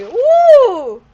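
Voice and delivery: loud high "ooh"; a big impressed whoop. Retold or imitated. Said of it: "he was like".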